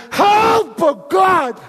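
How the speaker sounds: high, loud shout to God; desperate cry for help. Shown as a demonstration. Preacher showing the congregation a real cry for help, desperate shout. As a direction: scream out for help from the depths. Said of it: "have you ever done help like this".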